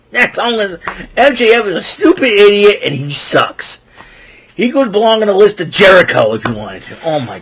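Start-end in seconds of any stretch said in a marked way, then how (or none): none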